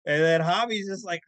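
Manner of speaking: ordinary talk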